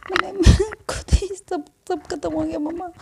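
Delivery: high, tearful pleading to mom; a girl near tears, blaming herself; high and tearful, voice catching; distressed daughter. Acted. Daughter sobbing to her mother.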